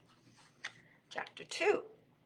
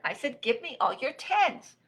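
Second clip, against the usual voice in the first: high, annoyed repeated demand; insist, having been ignored; insistent shout; 7 semitones higher, 4 dB louder than usual. Acted. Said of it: "shouted Rabbit".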